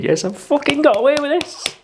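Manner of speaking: like he's pulled off a heist; gleeful triumph. Retold or imitated. Teen thinking he dodged the ban, high gleeful triumph. Gleeful thought after escaping ticket.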